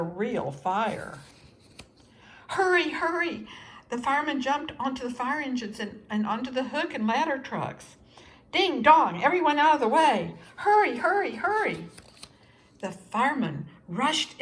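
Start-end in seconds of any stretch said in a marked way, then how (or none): 2.5-3.5 high, loud hurrying call; urgent call. A narrator's reading. Firemen rushing to a fire, urgent loud call. 8.5-11.9 loud, high and fast clearing shout; siren shout. A narrator's reading. Firemen racing through the city, loud urgent shout.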